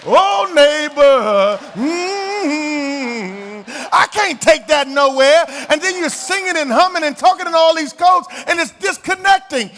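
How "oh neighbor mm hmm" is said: very slow, drawn-out pulpit croon; mock preacher tone. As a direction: stretch out oh neighbor in a churchy singsong. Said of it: "I got to hear you say".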